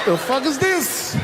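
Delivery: high, slow, stunned what-the; baffled disbelief. Acted. Comic mocking the slap with slow stunned disbelief. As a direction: drag it out in disbelief.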